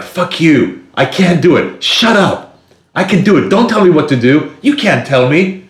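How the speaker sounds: high, loud defiant comeback; defiant outburst. Retold or imitated. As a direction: snap back at a bossy voice. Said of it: "And then you're like".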